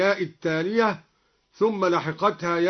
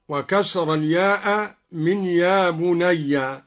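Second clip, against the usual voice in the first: ordinary talk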